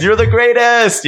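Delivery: high, drawn-out mock worship; acted adoration. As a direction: hail your idol in a hammed-up high voice. Quotes film character's adoring line.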